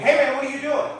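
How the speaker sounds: easygoing but loud and high; casual phone greeting. Acted. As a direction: greet a buddy loudly on the phone. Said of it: "It's, like, normal".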